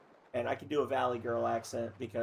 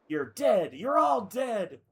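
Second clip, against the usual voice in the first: loud threat rising into a drawn-out shout; acted furious threat; 9 semitones higher, 6 dB louder, much slower than usual. Enraged dolphin threatening all the characters, furious. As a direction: build to a slow, high, hammed-up yell.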